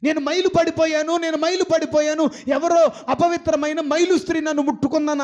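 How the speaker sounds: mock squeamish man complaining; mock disgusted voice. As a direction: whine as if defiled by a touch. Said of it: "అనలా".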